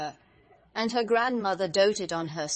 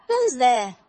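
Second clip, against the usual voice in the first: loud, higher call to the door; 4 semitones higher, 10 dB louder than usual. A narrator's reading. Grandmother answers knock at her door, loud call. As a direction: project it as a sharp question. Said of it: "hearing the big voice of the wolf".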